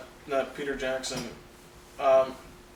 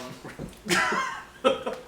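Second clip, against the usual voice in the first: high squealing laugh. Real, not acted. Laughs at own impression.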